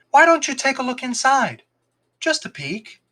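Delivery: put-on high, coaxing boy voice; coaxing fox boy. A narrator's reading. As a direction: lure him in gently.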